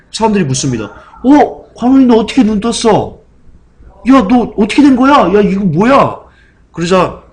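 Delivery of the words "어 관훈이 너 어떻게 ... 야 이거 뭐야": shocked crowd. Retold or imitated. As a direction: blurt it out in astonishment. People shocked he can see.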